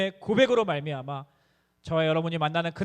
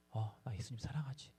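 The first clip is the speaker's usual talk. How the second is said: a frail, far-away murmur, much softer and lower; faint answer; 8 semitones lower, 14 dB quieter than usual. Retold or imitated. Son imitating his frail father's answer, very soft. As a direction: breathe it out like a weak old father.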